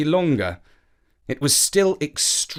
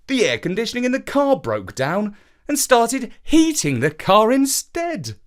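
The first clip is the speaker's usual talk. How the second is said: Dad explaining; 9 semitones higher, 3 dB louder than usual. A narrator's reading. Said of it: "Dad explained".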